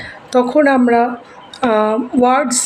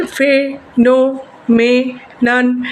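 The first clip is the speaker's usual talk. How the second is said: Phenomenon slowly as fe - no - me - nan; phenomenon drawn out syllable by syllable; split into syllables; much slower than usual. Shown as a demonstration. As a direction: break phenomenon into four separate beats. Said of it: "কীভাবে ভেঙে উচ্চারণ করতে পারছি".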